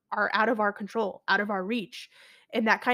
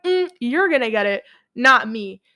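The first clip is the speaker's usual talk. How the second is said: insistent denial; 8 dB louder than usual. Retold or imitated. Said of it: "And I'm like".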